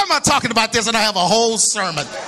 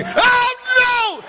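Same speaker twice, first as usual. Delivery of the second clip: retold panicked cry; 14 semitones higher than usual. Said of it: "they went crazy".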